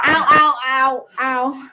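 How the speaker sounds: loud pained ow; hit hard crying out. Real, not acted. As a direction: yelp of real pain. Hit in the face.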